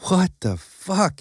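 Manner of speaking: slow, high, stunned; baffled. Acted. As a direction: drag it out in disbelief. Baffled exclamation.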